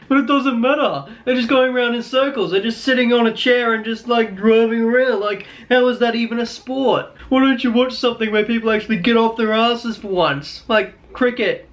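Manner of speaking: high put-on voice of a dismissive fan; Ferrari supporter. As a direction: scoff at F1 as if it's no sport, cricket slowed for effect. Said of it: "a Ferrari supporter would tell me".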